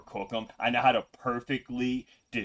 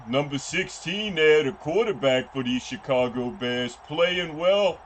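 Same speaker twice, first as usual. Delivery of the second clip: high, loud color-commentator character voice; 7 semitones higher, 7 dB louder than usual. Acted. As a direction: put on a loud, high-pitched sports analyst.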